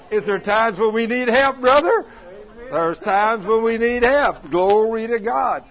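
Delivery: loud high preacher's cry; preaching at full force. Acted. Preacher calling out to his brothers, loud and high. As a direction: shout it out to the congregation.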